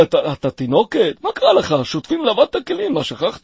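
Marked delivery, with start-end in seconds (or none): 1.2-2.9 high, fast teasing scold; mock him in a high voice. Mocking scolding, high pitched. (נזיפה לועגת בקול גבוה)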